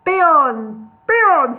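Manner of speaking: loud, high repeated call. Acted. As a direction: hail him across the yard. Gandalf calling out to Beorn.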